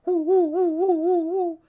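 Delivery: loud, high whooshing woo; high whirly-tube woo; tube whoosh noise. Shown as a demonstration. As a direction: imitate a swinging whirly tube. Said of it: "go like this".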